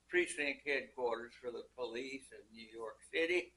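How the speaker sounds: usual speaking voice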